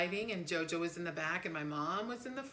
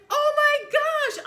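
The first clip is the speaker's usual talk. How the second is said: much higher, louder, slow and crying; panicked cry; 16 dB louder, slower than usual. Retold or imitated. Seeing dad's fingers stuck in the door, panicked cry. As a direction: shriek of horror at his crushed fingers. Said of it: "and I was like".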